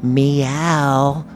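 lightly voiced lady's meow, drawn out. Storyteller mimics a mysterious lady talking to his cat, slow meow. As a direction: imitate a lady slowly meowing. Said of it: "I hear this lady's voice go".

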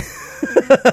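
a short, high, airy real laugh; breathy laugh. Real, not acted. Laughing after declaring he loves the movie. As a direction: let a quick laugh slip out. Laughs at own hard disagree.

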